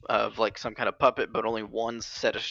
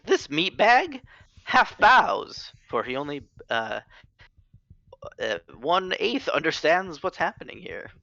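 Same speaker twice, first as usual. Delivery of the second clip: character voice; 6 semitones higher, 4 dB louder than usual. Acted. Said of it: "Eulogy says".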